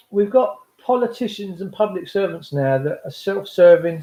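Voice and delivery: ordinary talk, nothing marked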